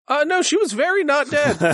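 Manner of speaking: lightly voiced timid horse; a hint of a nervous young horse; shy talking horse. Horse answers party.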